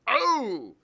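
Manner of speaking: loud, high exclamation; quite loud. A narrator's reading. Silver on seeing the squire's letter, quite loud. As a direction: burst out with it, loud. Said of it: "said he, quite loud".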